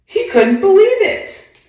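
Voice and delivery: very high, loud astonished squeal; stunned disbelief. Acted. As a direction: sound as floored as Babe. Babe Ruth stunned by the pitch.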